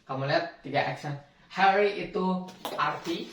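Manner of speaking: normal talking voice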